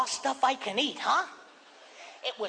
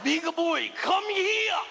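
Lord Toranaga; 2 semitones higher, much slower than usual. Retold or imitated. Sushi owner yelling at a big eater, warlord shout. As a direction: call out like a commanding warlord. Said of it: "reminded me of Lord Toranaga from Shogun".

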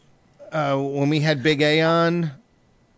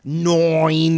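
long Long Island "noine"; Long Island accent; 4 dB louder than usual. Shown as a demonstration. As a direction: draw out "Nine" in mock Long Island accent. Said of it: "somebody from Long Island say the word nine".

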